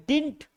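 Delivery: didn't as "dint" inside the sentence; correct pronunciation. Shown as a demonstration. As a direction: run didn't together as one syllable, "dint". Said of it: "तो इसको बोलेंगे".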